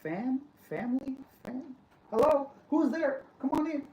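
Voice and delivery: rising, puzzled high voice; baffled, questioning the word fam; confused locals. Retold or imitated. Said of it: "So they're like".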